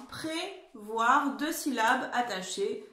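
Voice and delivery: prévoir said as two joined syllables, pré-voir; linked syllables. Shown as a demonstration. Teacher showing pupils Prévoir in two joined syllables. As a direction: glide Prévoir in one breath. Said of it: "c'est comme ça se prononce".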